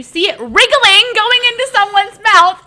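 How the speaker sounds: high, loud horrified telling; disgusted squeal. Real, not acted. Recounting childhood worm horror.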